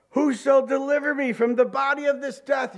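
high, loud anguished cry. Acted. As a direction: cry out desperate for rescue. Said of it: "Then he cries out".

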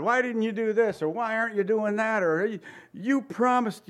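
high, whining mock complaint; whiny complaint. Preacher mimics believers whining at God, high and whiny. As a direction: whine at God like a spoiled child. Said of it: "We rail on God".

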